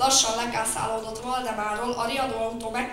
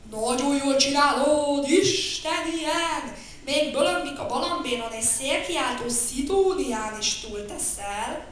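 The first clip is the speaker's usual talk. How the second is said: flattering bear; 5 semitones higher, 7 dB louder, slower than usual. Acted.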